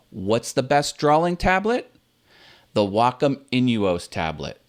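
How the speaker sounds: tablet rises on the question, Wacom Inuos tablet falls on the answer; ask then answer. Shown as a demonstration. YouTuber demonstrates a question-then-answer delivery to viewers. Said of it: "Now watch, it'll be better".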